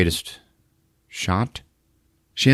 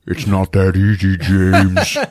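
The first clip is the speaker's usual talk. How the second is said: louder sinister taunt, laughing; mock creepy taunt; 4 dB louder, slower than usual. Possessed girlfriend taunting Jim again, mock sinister. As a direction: sneer his name. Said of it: "possessed taunt returns".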